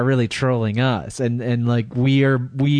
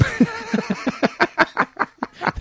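high giggling laugh. Real, not acted. Laughs at feces joke.

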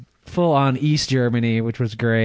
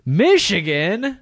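surprised echo; 6 semitones higher, much slower than usual. Retold or imitated. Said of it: "And he goes".

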